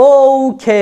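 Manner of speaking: "ওকে" slowly, both syllables long, puffed K; drawn-out British okay. Shown as a demonstration. Teacher showing a nicer okay, slow aspirated K. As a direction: stretch "ওকে" into oh-khey. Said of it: "এইভাবে বলবো".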